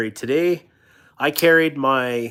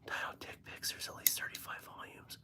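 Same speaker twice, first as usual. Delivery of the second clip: whisper; 23 dB quieter than usual. Real, not acted. Unvoiced whispered aside.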